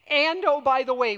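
high, loud "oh, by the way"; mimicking a pesky add-on request; mock afterthought. Mimics organizers' casual extra demand.

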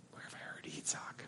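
whispered aside to himself. Real, not acted. Pastor whispering an aside to the congregation. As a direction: breathe "Yitzhak" as a private question.

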